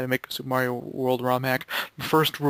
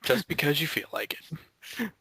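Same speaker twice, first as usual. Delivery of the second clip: whisper; 5 dB quieter, slower than usual. Real, not acted. Teasing aside.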